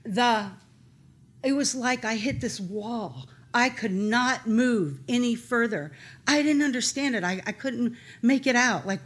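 usual speaking voice

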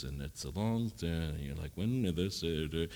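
bore them with a flat drone; droning monotone. Retold or imitated. Preacher imitating a droning Bible expert. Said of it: "they talk like this".

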